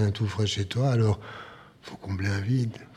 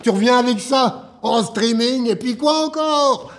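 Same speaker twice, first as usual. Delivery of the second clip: raised, incredulous protest climbing high; scornful exasperation; 5 semitones higher than usual. Acted. Man rejecting her streaming idea, scornfully exasperated. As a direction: dismiss the idea as absurd.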